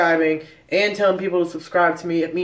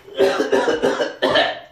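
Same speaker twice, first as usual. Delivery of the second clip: rough unvoiced coughs; coughing fit. Real, not acted. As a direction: hack it out, choking on dust. Cinnamon challenge, coughing continues.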